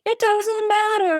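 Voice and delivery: high, slow, gushing singsong; mock dreamy young woman in love; lovestruck younger self. Twenty-two-year-old self in love, lovestruck.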